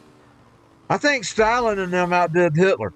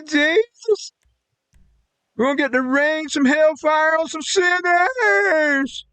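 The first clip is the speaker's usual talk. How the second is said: higher, slow hammed-up hallelujah cheer; sing-song gleeful mock praise for bombing; mock churchgoer praising war; 10 semitones higher, slower than usual. Mocking church folk who cheer for bombing sinners, high and hammed-up. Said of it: "That's exactly what they do".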